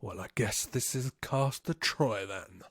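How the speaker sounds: quoting the villain's cool reply; menacing movie line. Retold or imitated. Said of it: "Castor Archer replies".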